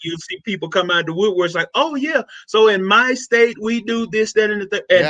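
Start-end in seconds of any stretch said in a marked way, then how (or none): 1.7-4.8 higher-pitched imitation of a helpful peer; eager colleague chiming in. Social work friends responding to a video, eager chiming in. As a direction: chime in on a raised pitch.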